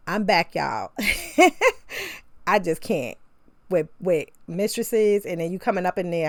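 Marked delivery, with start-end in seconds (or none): 1.0-2.2 a sudden, much higher laugh; high laugh burst. Real, not acted. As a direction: cackle at her own rant. Bursts out laughing after rant.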